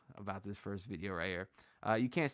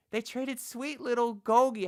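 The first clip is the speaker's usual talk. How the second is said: mock lament; 12 semitones higher, 7 dB louder, slower than usual. Real, not acted. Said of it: "fond lament for traded player".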